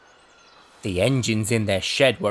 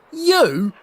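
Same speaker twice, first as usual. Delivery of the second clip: high, loud scornful snort of a word; 5 dB louder than usual. A narrator's reading. Henry scoffs at James, scornful. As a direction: scoff at the idea. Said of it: "Henry snorted".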